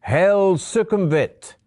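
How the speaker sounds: loud, high, drawn-out cry; ringing shout of homage; shouted hail. A narrator's reading. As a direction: shout the salute slowly and with full force. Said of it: "and shout".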